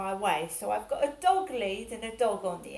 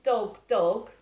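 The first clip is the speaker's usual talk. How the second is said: mild mock shout, louder than the telling; retold kid's shout; 5 dB louder than usual. Kid shouting at a passer-by, mildly imitated shout. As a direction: imitate a kid calling out, only half shouted. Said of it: "those kids had shouted".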